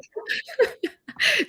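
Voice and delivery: a sudden high-pitched delighted laugh. Real, not acted. Listener laughing at the thought of bedtime affirmations.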